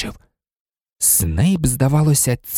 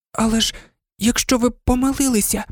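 high, hesitant little voice; tentative high-pitched plea; timid protest; 10 semitones higher than usual. A narrator's reading. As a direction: venture an objection cautiously. Timid student pleads with angry professor.

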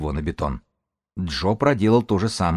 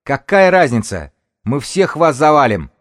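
high, loud, heated yell; shouted threat; 7 semitones higher, 8 dB louder than usual. Acted. Young lookout threatens the whole party, high loud yell. Lad screams threats at opponents.

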